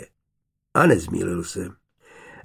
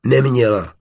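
blurted, louder, instant; 5 dB louder than usual. A narrator's reading. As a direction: blurt it out at once. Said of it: "vyhrkla okamžitě". (vyhrknuté okamžitě, hlasitěji)

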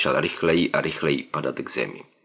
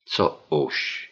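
softer, lower baffled question; puzzled; 3 semitones lower, 5 dB quieter than usual. Acted. Narrator asks the woman, puzzled and softer. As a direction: ask without understanding at all. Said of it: "zeptal jsem se nechápavě".

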